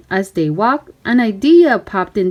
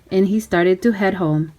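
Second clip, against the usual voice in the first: plain reading voice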